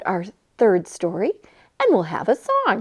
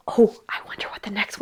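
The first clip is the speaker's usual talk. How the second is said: breathy whispered aside; 3 semitones lower, 10 dB quieter, much faster than usual. Acted. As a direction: whisper it to the children. Aside between song verses.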